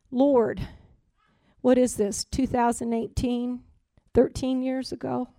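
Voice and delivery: soft, pleading talk to God; quiet retold prayer.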